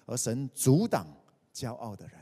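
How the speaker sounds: ordinary talk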